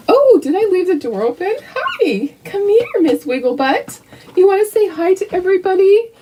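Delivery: high cooing pet voice. Real, not acted. To her dog Sophie, high sweet baby talk. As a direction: coo at the little dog.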